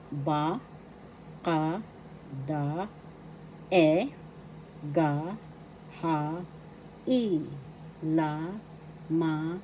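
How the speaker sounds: each consonant said as a clear syllable, la, ma, na; Tagalog syllable recital. Shown as a demonstration. Teacher drilling the class on Tagalog consonants, clear syllables. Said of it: "that's how it's pronounced".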